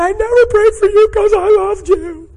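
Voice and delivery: very high, loud mock crying; hammed-up bawled confession; imitated tearful wail. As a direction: imitate someone confessing through sobs, pitch held way up. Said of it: "It was just cry, tears, slobber".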